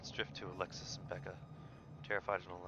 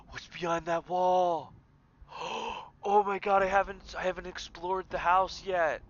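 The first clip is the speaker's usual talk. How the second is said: high loud gasping surprise; startled; 10 semitones higher, 11 dB louder than usual. Real, not acted. Streamer spotting the hidden room, high startled exclaim. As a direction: gasp and exclaim.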